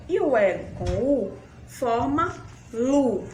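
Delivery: slow, clear teacher drill, each syllable spelled out. Shown as a demonstration. Teacher drills the L syllables slowly for children, clearly spelled out. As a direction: sound out each pair for small kids. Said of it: "teacher drilling syllables".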